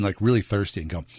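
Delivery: ordinary delivery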